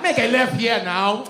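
loud, scolding Nigerian GPS. Retold or imitated. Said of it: "a Nigerian on a G P S".

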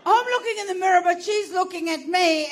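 ordinary delivery

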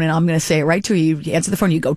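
ordinary delivery

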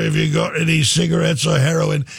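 lazy London rocker asking for smokes. Retold or imitated. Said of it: "All the way out to [name]".

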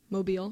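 Mobile as mo-BEEL; local pronunciation. Shown as a demonstration. Explaining the southern city's name, Mobile said mo-BEEL. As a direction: stress the long EE in Mobile. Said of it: "how to pronounce it".

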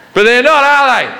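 high-pitched mock official; exasperated retort. Security guy answers bomb excuse, high exasperated retort.

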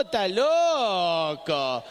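slow stretched-out está loco; mock outraged father; incredulous drawl. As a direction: drag it out in disbelief. Acted father's exasperation.

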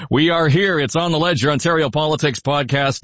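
upbeat host opening, pitched high; radio host welcoming listeners; podcast intro. Acted. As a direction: announce the show with energy.

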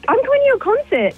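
high-pitched mild fangirl gush; excited fan. Retold or imitated. Caller retelling what she told the star, excited.

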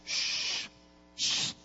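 soft unvoiced hushing sound; quiet steady shh to halt a horse; shushing a horse. Shown as a demonstration. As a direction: hush the horse to calm it. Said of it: "haciéndole shh".

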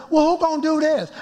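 high-pitched retold complaint; upset plea to God. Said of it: "and I'm really upset".

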